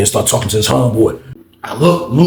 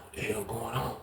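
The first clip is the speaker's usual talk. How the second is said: hushed; 2 semitones lower, 21 dB quieter than usual. Retold or imitated. Watching in secret.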